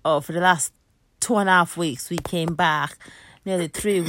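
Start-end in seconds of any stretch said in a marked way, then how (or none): none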